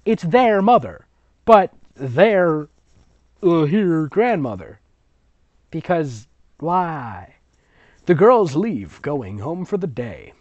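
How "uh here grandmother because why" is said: ordinary delivery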